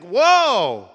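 surprised. Retold or imitated. Reacting to son going underwater.

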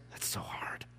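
whispered, teasing aside to the students; mock whisper aside. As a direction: whisper it like a joking secret. Joke about parents to students.

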